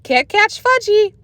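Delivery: high, slow sing-song taunt; toddler taunt. A narrator's reading. Toddler Fudge taunts the big kids, high sing-song. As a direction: tease the chasers like a little kid. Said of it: "he hollered".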